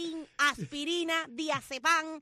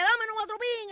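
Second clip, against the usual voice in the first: customer demanding; 7 semitones higher, 5 dB louder, slower than usual. Retold or imitated. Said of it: "Le decía".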